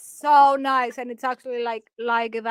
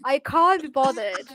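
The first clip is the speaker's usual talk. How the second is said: "can't" with a broad English ah; English "I can't be bothered"; British can't. Shown as a demonstration. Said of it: "Y'all do can't".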